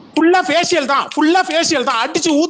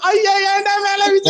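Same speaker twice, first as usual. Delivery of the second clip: drawn-out, high-pitched fake wail; hammed-up sarcastic wailing; mock crying; 8 semitones higher, slower than usual. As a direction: pretend to bawl, stretching the words out sarcastically. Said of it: "நான் தான் அழுகுறேன்".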